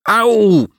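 high, loud dog's yelp; yelped moan. Acted. Dog hit by a thrown bottle, yelped moan. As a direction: cry "ow" like a struck dog. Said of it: "jęknął pies".

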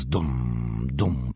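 low, drawn-out drum boom; thundering drum. A narrator's reading. Narrator voicing giant fists on hollow rock, thundering drum imitation. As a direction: imitate slow, heavy drumbeats. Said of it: "Nowy grzmot".

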